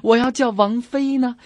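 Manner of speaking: ordinary talk, nothing marked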